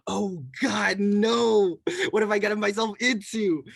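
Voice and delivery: exaggerated oh-no reaction, drawn from a retelling; mock dismay. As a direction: wail it in hammed-up regret. Acts out own dismay at being reported.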